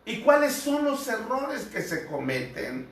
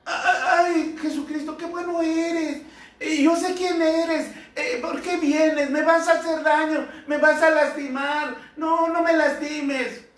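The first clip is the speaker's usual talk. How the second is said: much higher, wheedling demon voice with a laugh; flattering demon; 9 semitones higher than usual. Acted. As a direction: fawn over Jesus like a scheming demon. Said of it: "Imagínate un demonio". (demonio adulador con voz mucho más aguda)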